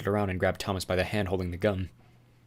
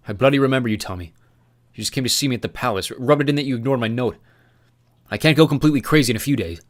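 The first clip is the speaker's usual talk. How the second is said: bitter retort; 4 semitones higher, 7 dB louder than usual. Acted. Newt answers with bitterness.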